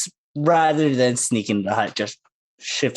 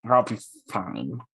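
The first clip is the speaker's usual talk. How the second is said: soft, low under-the-breath mutter; muttering to himself; 5 semitones lower, 6 dB quieter than usual. Acted. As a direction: talk quietly to yourself, half swallowed. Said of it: "whispering to himself".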